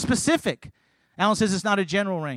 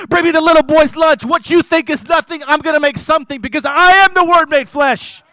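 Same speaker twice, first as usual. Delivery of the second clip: higher, louder, commanding voice of Jesus; Jesus speaking; 8 semitones higher, 11 dB louder than usual. Acted. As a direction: thunder it as the mighty Jesus. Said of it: "Not my Jesus".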